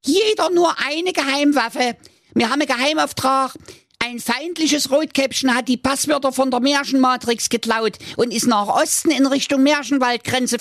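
high squeaky commander giving orders; brief the troops like a tiny general; commanding dwarf. Acted. Commander dwarf Zwenny briefs his agents, squeaky and bossy.

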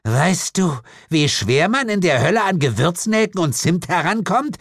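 high, exasperated question; aggrieved demon mother. Acted. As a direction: complain about shopping in Hell.

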